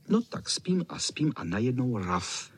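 ordinary delivery, nothing marked

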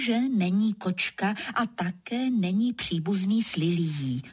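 ordinary delivery, nothing marked

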